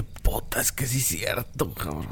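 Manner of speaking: whispering. Retold or imitated. Muttered realization reacting to video.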